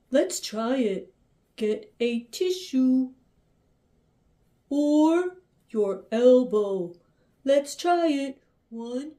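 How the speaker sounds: gentle bear talking to his cubs; Papa Bear voice. Acted. Puppet Papa Bear talks to cubs.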